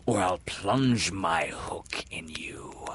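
slow, whispered threat; imitating Hook. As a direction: menace from the shadows. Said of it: "imitated the voice of Hook".

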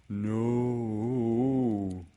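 long, drawn-out storybook no; Pooh. Acted. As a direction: stretch the refusal out as a little bear. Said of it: "said Pooh".

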